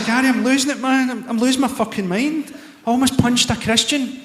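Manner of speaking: exasperated venting to a pal; frantic plea. Retold or imitated. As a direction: sound like you're cracking up, pleading with an old friend.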